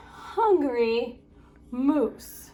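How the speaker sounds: ordinary delivery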